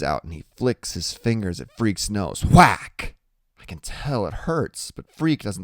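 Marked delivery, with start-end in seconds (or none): 2.4-3.1 loud, high whack; sharp whack. A narrator's reading. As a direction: snap the sound out. Sound of flick.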